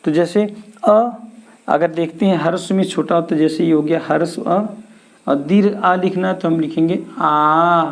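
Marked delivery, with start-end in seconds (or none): none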